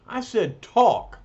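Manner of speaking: insist, losing patience; angry repeat. Acted. Ben repeating his demand to the fake, impatient.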